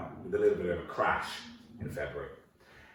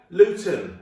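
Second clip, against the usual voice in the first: loud, certain dad; blunt confident dad; dad answering; 7 semitones higher, 9 dB louder than usual. Acted. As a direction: bark the answer like a know-all father. Dad's confident wrong answer.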